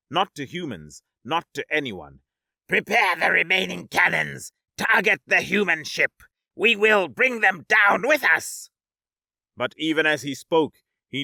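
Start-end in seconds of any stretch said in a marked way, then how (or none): 2.7-8.7 loud, higher commanding shout; shouted orders. Acted. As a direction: bark desperate battle orders. General shouting orders in defeat.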